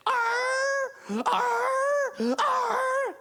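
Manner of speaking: high strained barks with a laugh; dog barking. Acted. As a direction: bark like tied-up hounds. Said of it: "they were going like this".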